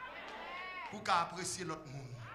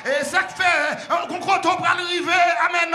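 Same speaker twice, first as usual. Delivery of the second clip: loud, high shouted preaching; preaching at full force; 8 semitones higher, 17 dB louder than usual. Real, not acted. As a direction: drive the message home to the church. (prêche à pleine voix, criée)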